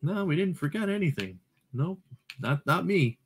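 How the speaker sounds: breezy, high-pitched imitation of his own confidence; happy, self-assured. Player mimicking himself happy after restarting the game, high and cheerfully oblivious. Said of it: "I was happy and was like".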